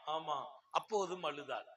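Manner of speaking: normal talking voice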